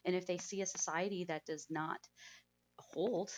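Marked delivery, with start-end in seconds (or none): none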